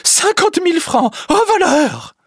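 high loud wail over lost money; wife shrieking over the stolen francs; shrieking cry. Acted. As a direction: shriek it in alarm. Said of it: "crie la femme".

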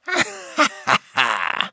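eerie, mirthless cackle; sinister, gloating laughter; lugubrious laugh. Acted. Recluse seizing the bohémienne, lugubrious laugh. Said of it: "le rire lugubre de l'étrange femme".

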